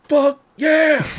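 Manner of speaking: high, drawn-out mock yell; imitated shouting. Worker cheers before heading back inside, shouting. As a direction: cheer like a pumped-up fan. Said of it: "he's, like, shouting it out loud".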